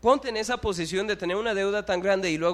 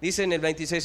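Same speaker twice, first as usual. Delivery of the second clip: usual speaking voice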